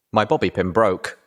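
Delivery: ordinary delivery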